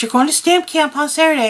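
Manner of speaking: husband repeating the plan with eager emphasis; insistent husband announcement. Retold or imitated. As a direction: press the news on her eagerly.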